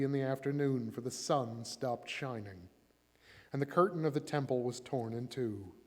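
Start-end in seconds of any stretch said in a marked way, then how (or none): none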